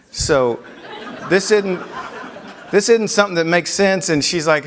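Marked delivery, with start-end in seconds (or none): 1.3-4.1 laughing disbelief. Real, not acted. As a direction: let a laugh break through as you repeat it.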